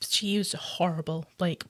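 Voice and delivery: hushed disgusted whisper. Real, not acted. Disgusted aside.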